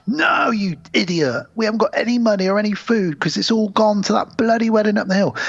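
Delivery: high-pitched mock indignation; exasperated townsfolk, put on; scornful rebuff.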